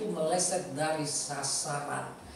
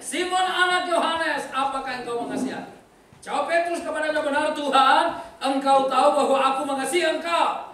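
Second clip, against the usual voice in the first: loud, raised, declamatory Bible reading; scripture reading; 4 semitones higher, 7 dB louder than usual. A narrator's reading. Preacher reads Jesus and Peter's exchange to the congregation, declaimed. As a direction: read the verses out to a full congregation with big emphasis.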